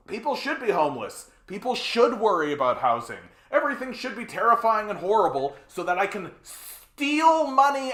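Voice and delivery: loud furious rant; angry rant. Real, not acted. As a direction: tear into them with rage.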